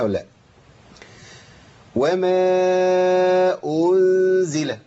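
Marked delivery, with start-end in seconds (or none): 2.0-4.8 وما أنزل with the hamza vowel stretched toward a waw, slow; extra waw, wrong. Shown as a demonstration. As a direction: drag the vowel before أنزل. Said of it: "هقع في خطأ دلوقتي". (خطأ مد الضمة حتى تصير واوًا)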